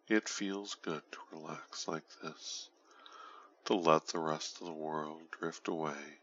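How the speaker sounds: ordinary delivery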